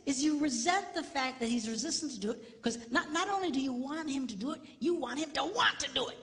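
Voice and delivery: ordinary talk, nothing marked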